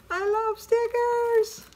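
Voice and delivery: high, slow, gushing delight; delighted squeal. Real, not acted. Tells viewers she loves stickers, high and gushing.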